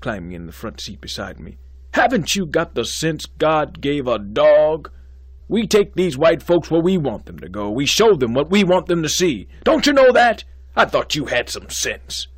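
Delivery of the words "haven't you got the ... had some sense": loud, high, scornful lecture; angry scolding. Acted. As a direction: scold him like a fool. Dean lectures student angrily.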